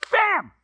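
very high, abrupt "bam"; sudden bang. Acted. Storyteller voices the rope snapping, sudden high bang.

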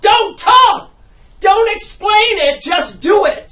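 high, loud, slow barked orders; furious shout. Acted. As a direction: scream at her to stop talking. Director yelling during take.

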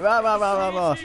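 imitating a friend's empty ranting; mocking blather. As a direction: parody someone ranting on. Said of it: "He's like".

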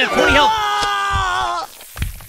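slow, high shouted alarm; dragged-out panicked yell. Real, not acted. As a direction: cry out the bad news in alarm. Low health panic in game.

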